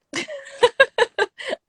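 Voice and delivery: sharp high giggle; high self-conscious laugh. Real, not acted. Laughs at her own dating struggle.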